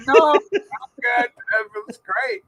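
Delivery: slow, drawn-out fake politeness; declining to follow her, slow and mock gracious; mock polite reply. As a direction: stretch it out, pretending to be gracious. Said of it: "I said".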